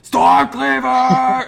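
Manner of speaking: a huge, slow, high roar of his own name; bellowed war cry. Acted. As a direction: roar it out while spitting blood. Bloodied warrior bellows his name.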